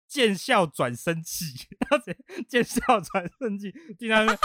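laughing through the words; cracking up mid-sentence. Real, not acted. Cracking up retelling the soldier's angry glare.

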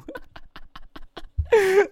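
long high-pitched laugh. Real, not acted. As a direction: laugh helplessly. Laughing recalling the scene. (高声笑)

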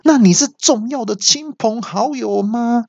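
high-pitched angry questioning; mock irate interrogation; growing anger. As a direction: demand it with pitch way up. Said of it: "越来越生气".

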